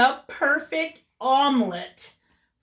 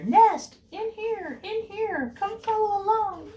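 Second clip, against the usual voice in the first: sign voice; 12 semitones higher than usual. Acted. Cat's signs voiced in story.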